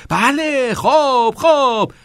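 high, loud, excited encouragement; insistent urging. A narrator's reading. Teacher presses pupil to keep composing, insistent loud urging. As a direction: egg him on eagerly. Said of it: "کتینگ مصرانه ادامه داد".

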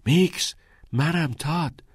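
high, unforced call. A narrator's reading. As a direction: call to a friend through the door. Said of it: "صدا زد".

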